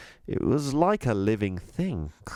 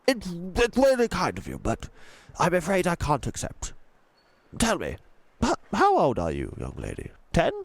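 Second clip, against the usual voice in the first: posh tramp, gently refusing; posh old tramp; 8 semitones higher than usual. A narrator's reading. Tramp refusing the girl's money, posh and kind. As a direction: turn down the child's gift kindly, posh.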